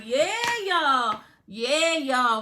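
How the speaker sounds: lightly voiced show-off bragging; mock gloating. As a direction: gloat like you just got rich. Mocking gloating characters.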